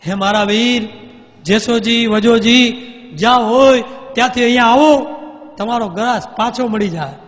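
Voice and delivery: queen shouts into the dark night for her brothers; loud, high call carried far. Acted. Queen calls to her brothers across the dark, shouting. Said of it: "ત્યાં એ રાણીએ બૂમ મારી".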